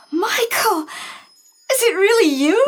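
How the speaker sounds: high, emotional cry after a gasp; gasp, then cry out in disbelief; astonished, joyful reunion; overwhelmed surprise. Acted. Reunited with lost fiancé.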